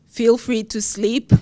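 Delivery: ordinary talk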